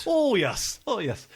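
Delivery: high, loud mock northern accent; northern accent attempt. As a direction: overdo a bad northern accent, swooping down. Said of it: "I can't do a northern accent".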